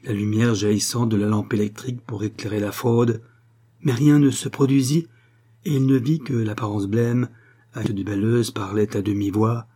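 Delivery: ordinary delivery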